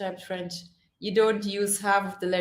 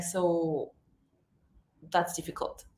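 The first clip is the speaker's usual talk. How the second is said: mock quiet sigh; 5 semitones lower, 8 dB quieter than usual. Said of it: "So be like".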